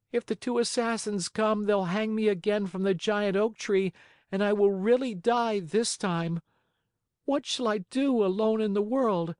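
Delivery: put-on high, plaintive little-boy lament; high-pitched forlorn puppet, rising in distress; pleading puppet boy, grieving. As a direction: beg and lament in a high child voice. Pinocchio laments to dead fairy.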